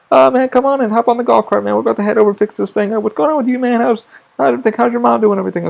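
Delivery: chummy chatter, pitched well up; eager, sociable acted-out small talk; imitated friendly tech. Acts out techs' friendly chat.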